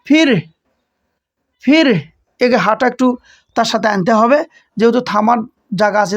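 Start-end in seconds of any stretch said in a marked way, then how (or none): 0.1-0.3 ফির with the র sounded at the stop; rolled pause ending. Shown as a demonstration. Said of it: "এইভাবে উচ্চারণ করতে হবে". 1.6-1.9 ফির again with a clear final র; rolled pause ending. Shown as a demonstration. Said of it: "এইভাবে উচ্চারণ করতে হবে".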